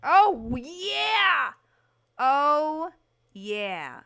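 loud, drawn-out exclaiming; big whooping delight that settles at the end; thrilled approval. Real, not acted. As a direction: revel in it at full voice. Delighted by the new wall color.

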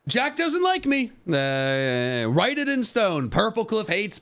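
high, whiny pretend complaint; mock whiny voice. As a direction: whine it like a hurt kid. Mock whining about rival.

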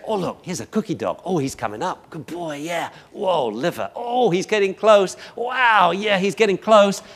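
gushing treat praise. Retold or imitated. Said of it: "You say".

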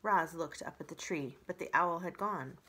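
ordinary delivery

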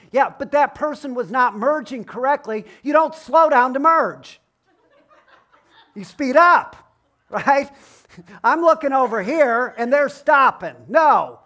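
hammed-up fury at bad merging; mock road rage. Preacher confessing his commute irritation, mock angry rant.

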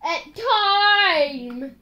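stretched-out, full-voiced dread; drawn-out announcement. Real, not acted. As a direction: announce it with a long "time". Announces the penalty, then repeats hushed.

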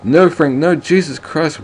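villain cries out over his dead partner; anguished cry. Acted. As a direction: cry out as the friend dies. Partner dying.

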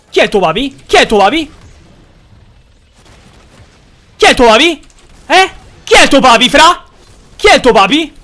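high, loud mocking chant; loud taunt. Streamer goading a friend on Discord, loud high taunt. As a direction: yell it over and over to goad him.

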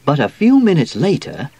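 ordinary delivery

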